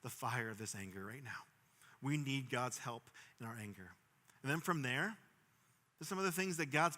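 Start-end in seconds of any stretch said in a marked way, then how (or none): 0.0-1.4 quiet prayer. Shown as a demonstration.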